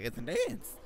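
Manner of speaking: jumping high on the last word; mock high-pitched exclamation; retold squeaky exclamation. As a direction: quietly squeak out the retold answer. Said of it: "I'm like".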